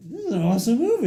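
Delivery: high, loud excited exclamation; delighted reaction. Retold or imitated. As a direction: burst out with amazed enthusiasm. Acting out own delighted reaction.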